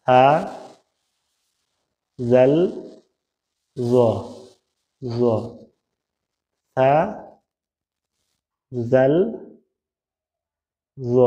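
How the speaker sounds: সা জাল জ said slowly, tongue tip on the upper front teeth; spaced-out recitation of সা, জাল, জ; letter pronunciation demo. Shown as a demonstration. Teacher modelling Arabic letters for students, slow and careful. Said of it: "জিহ্বার আগা, সামনের উপরের দুই দাঁতের আগার সাথে লাগিয়ে".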